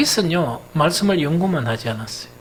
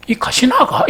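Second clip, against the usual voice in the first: high, loud incredulous snap through laughter; dumbfounded robber; 8 semitones higher, 6 dB louder than usual. Retold or imitated. The robber reacts to the sister's scolding, incredulous and loud. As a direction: play the stunned robber, laughing. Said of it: "강도가 기가 막혀가지고".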